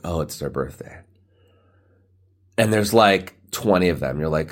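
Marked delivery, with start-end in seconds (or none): 0.0-1.0 unimpressed. Retold or imitated. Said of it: "So you're like".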